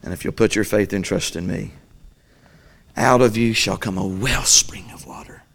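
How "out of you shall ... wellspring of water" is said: whispered promise. Acted. Jesus promising the believer, in a whisper.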